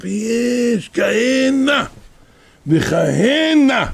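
slow, high, drawn-out cry; preaching shout. Real, not acted. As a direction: stretch each word out like a preacher at full force. Preacher's climactic exclamation. (קריאה איטית ורמה של דרשן)

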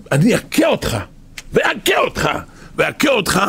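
booming repeated threat, peaking in a shout; God's wrath rising over Pharaoh; thundering threat. Acted. God warns Pharaoh of the plagues, shouting.

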